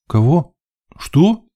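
high, rising questions; taken aback, voice jumping up; incredulous double take; startled. A narrator's reading. Narrator startled by partner's news.

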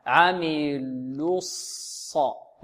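عملوا الص very slow and low, "lu" closing on s; rounded lam into sad. Shown as a demonstration. Teacher demonstrating the transition, rounded lam into sad. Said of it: "فننتقل من اللام المضمومة".

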